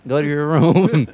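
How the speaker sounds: exasperated son repeating himself loudly; insistent repeat to mom. Acted. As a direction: drag out the order for mom. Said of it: "It's like, it's like".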